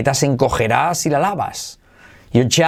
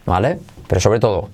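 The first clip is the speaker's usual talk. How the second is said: normal talking voice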